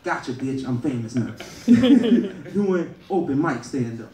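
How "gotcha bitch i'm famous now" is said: ordinary talk, nothing marked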